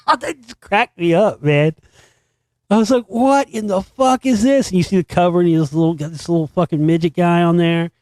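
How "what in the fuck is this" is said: incredulous. Retold or imitated. Incredulous reaction to the song.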